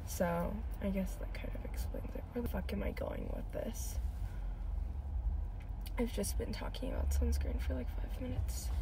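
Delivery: hushed self-conscious aside; barely voiced, half to herself; whispering. Real, not acted. Trailing off in an embarrassed aside.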